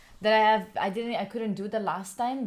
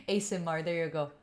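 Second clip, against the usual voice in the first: usual speaking voice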